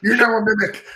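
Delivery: high, loud playful announcement; hammed-up game master pronouncement; mock proclamation. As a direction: call out a silly game-changing decree.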